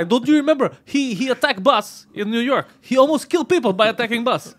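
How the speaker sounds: high, loud, indignant foreign fighter impression; Khabib. Fighter recalling the bus attack to press, mock indignant. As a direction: argue the grievance in mock outrage.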